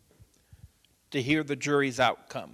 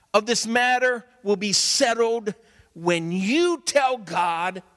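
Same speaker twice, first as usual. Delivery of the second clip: louder and higher, slowly building a sermon-like charge; mother exhorting her son; 7 semitones higher, 7 dB louder, slower than usual. Retold or imitated. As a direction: preach it at him like his mother would.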